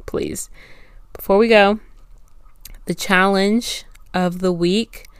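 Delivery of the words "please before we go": ordinary delivery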